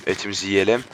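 ordinary talk, nothing marked

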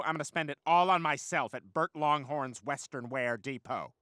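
ordinary talk, nothing marked

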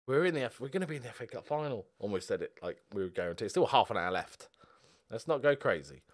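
ordinary talk, nothing marked

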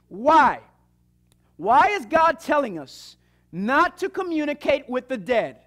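ordinary talk

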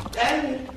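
high, drawn-out "ding" like a bell; long high-pitched end-of-round bell; imitated bell ding. Shown as a demonstration.

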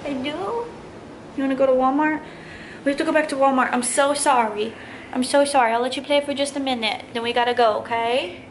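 high, sweet apologetic voice. Real, not acted. Talking to her toddler.

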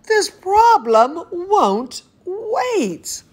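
lively storybook reading voice; animated picture-book narration; reading to children. Acted. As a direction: read it like bedtime story time for a toddler. Reads picture book aloud.

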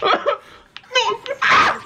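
real high-pitched shriek of fright; startled scream. Real, not acted. Player jumped by something in game, startled scream. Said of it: "I just screamed".

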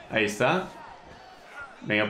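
normal talking voice

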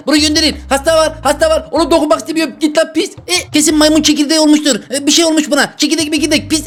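high-pitched, playful revulsion; squealing-high mock alarm; mock disgusted panic. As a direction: send the patient away in a high, panicky voice. Mock panic at a diseased patient.

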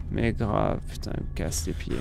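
whispering annoyed; whispered complaint. Real, not acted. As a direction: mutter it under your breath. Muttered complaint.

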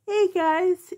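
high, sing-song greeting to the pets; cooing greeting. Real, not acted. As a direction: coo at them like babies.

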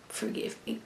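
breathy whisper, half to herself; a quick unvoiced sorry; whispered apology. Real, not acted. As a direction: whisper it like a sheepish confession. Apologising for messy hair on camera.

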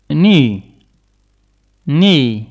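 "Knee" starting straight on the N; silent K. Shown as a demonstration. English teacher models knee for French speakers, silent K. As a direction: say "Knee" loudly with no K sound. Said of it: "On ne prononce pas le K".

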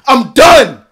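loud, final outburst; furious shout of quitting; shouted I'm done. Real, not acted. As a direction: yell it like slamming a door. Quitting rant, repeated declaration.

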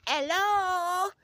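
high, drawn-out squeaky hello; high call. Acted. Greeting the viewers with a high call-out. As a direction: sing it out high like hailing the crowd.

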